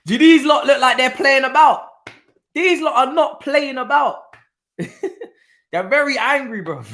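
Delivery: high, laughing, words stretched out; cracking up while marvelling at them; amused giggling commentary; laughing disbelief. Real, not acted. Laughing at the home side.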